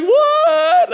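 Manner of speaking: very high, sudden startled cry; stunned what. Retold or imitated. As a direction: squeak out the shock. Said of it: "I'm like".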